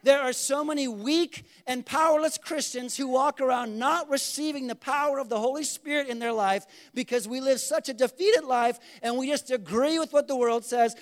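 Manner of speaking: ordinary talk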